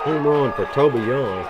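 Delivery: loud, slow, high home run call; excited home run call. Real, not acted. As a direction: belt the name out slowly.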